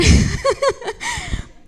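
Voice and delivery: real burst of high laughter. Real, not acted. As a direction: laughs out loud at the memory. Laughing while retelling the news. (risa real y aguda)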